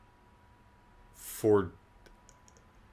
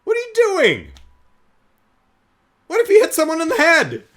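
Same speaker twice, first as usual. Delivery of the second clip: high, loud incredulous scolding; hammed-up shrill disbelief, much higher and louder; exasperated ranting; 7 dB louder than usual. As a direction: berate Clark in mock outrage. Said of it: "And I'm like".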